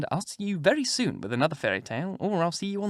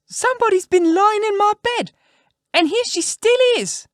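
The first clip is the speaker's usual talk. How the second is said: put-on very high, loud squeaky baby-bear voice; little wee bear; 8 dB louder than usual. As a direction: squeal it out as a tiny, outraged bear. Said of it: "in his little wee voice".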